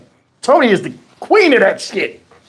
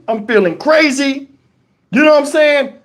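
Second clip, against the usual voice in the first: ordinary talk, nothing marked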